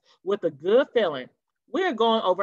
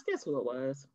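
ordinary delivery, nothing marked

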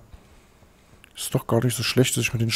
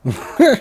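laugh; 4 dB louder than usual. Real, not acted. Amused by German villain.

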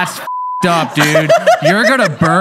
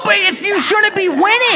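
playful shouting; 12 semitones higher than usual. Real, not acted. Friends shouting over the game result. Heated mock argument.

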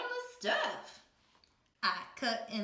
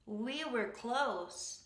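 plain reading voice

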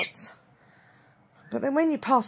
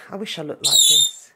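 usual speaking voice

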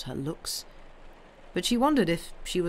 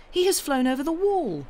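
put-on high, excited little girl; excited cry; 9 semitones higher, 4 dB louder than usual. A narrator's reading. Girl watching the robin fly off, crying out excitedly. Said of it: "Mary cried out".

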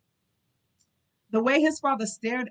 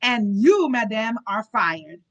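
much louder, slow and cutting; furious firing; 7 dB louder, slower than usual. Acted. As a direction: bark the dismissal at her. Duke furiously dismisses the nurse.

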